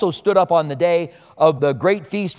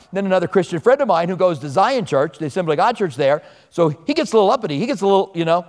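ordinary delivery

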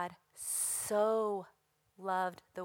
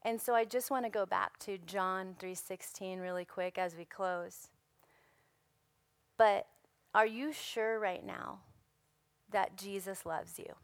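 usual speaking voice

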